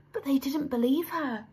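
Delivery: plain reading voice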